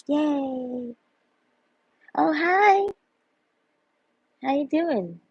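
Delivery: high, bright mock valley girl greeting. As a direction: squeal hello like a valley girl.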